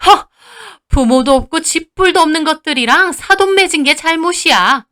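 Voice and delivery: scoff, then high indignant sneer; contemptuous scorn. Acted. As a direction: belittle the sisters' family. Mother-in-law sneers at orphaned sisters. (멸시하듯 비꼬는 시어머니)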